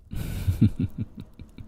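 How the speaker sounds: voiceless, teasing giggle; teasing chuckle. Acted. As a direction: chuckle slyly at the listener's plight. Teasing listener who wants to scream.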